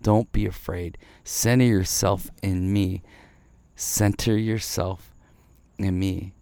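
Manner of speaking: soft, low, tender reassurance; whisper-close comfort, like a shepherd to his flock; gentle voice of the Spirit. Retold or imitated. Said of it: "teaching you every single day".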